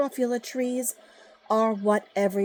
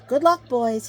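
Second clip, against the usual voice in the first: higher, louder farewell call; Lorax crying out; 4 semitones higher, 6 dB louder than usual. A narrator's reading. Lorax sending his Barbaloots away, calling out. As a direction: call out goodbye to the departing Barbaloots. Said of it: "he cried".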